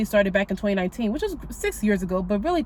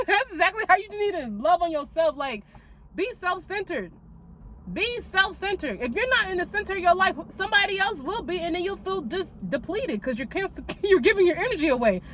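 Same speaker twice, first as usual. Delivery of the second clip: ordinary talk, nothing marked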